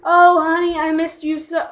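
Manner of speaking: hammed-up lovesick boyfriend. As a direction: swoon like a lovesick sap. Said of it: "and he's like".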